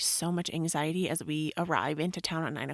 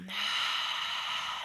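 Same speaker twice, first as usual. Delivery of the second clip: slow exhale sigh; 3 semitones higher than usual. Shown as a demonstration.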